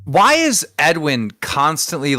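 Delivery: normal talking voice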